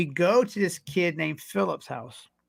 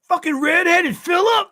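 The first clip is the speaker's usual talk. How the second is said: much higher, louder, shouting in disbelief; excited shout; 12 semitones higher, 5 dB louder than usual. Retold or imitated. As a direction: yell it like a stunned old friend. Friend Lloyd reacts on the phone.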